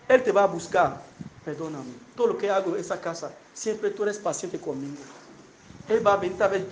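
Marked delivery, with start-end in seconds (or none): 1.4-5.0 lower, soft, humble apology; quiet begging for forgiveness; repentant plea. Retold or imitated. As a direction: murmur it like a husband ashamed. Repentant husband asks forgiveness.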